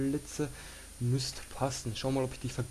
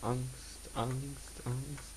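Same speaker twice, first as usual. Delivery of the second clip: quiet fearful repeating; 7 dB quieter, much slower than usual. Real, not acted. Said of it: "Ich hab".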